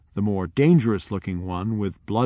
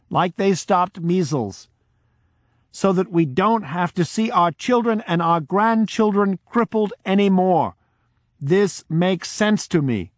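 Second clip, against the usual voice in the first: high, louder, impassioned speech to the village; forceful plea; 8 semitones higher, 3 dB louder than usual. Retold or imitated. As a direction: speak for all the people with conviction.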